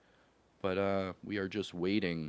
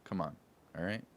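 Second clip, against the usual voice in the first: ordinary delivery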